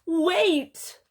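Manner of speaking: high, loud interruption; urgent halt. Acted. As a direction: cut in as a flustered storybook bear. Bear stops his friends.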